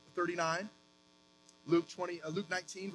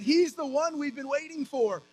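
crowd's eager cry; 5 semitones higher, 4 dB louder than usual. Retold or imitated. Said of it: "they were saying".

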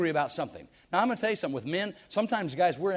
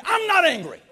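much higher, louder snapped denial; angry denial; 11 dB louder than usual. Retold or imitated. Angry person denying anger to a friend, loud high denial. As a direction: bite back like someone who is clearly angry. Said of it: "they're obviously angry".

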